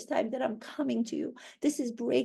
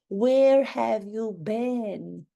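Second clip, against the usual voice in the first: drawn-out demand; 4 dB louder, much slower than usual. Acted. Same question, drawn out louder.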